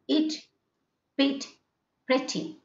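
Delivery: "It, pit, pretty" with brief vowels, spaced apart; short E. Shown as a demonstration. Teacher repeating the example words, short E. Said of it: "prolonging the E sound".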